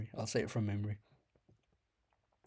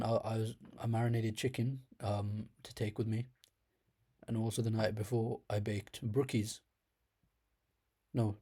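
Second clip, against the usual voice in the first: ordinary talk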